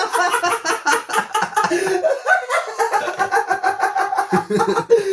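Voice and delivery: long high-pitched laughing fit; helpless laughter. Real, not acted. Host losing it at a Wikipedia quote, helpless laughter.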